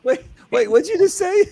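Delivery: real incredulous laugh through the words; laughing disbelief. Real, not acted. Host to guest about his mom's house, laughing disbelief. Host reacts to surprising turkey plan.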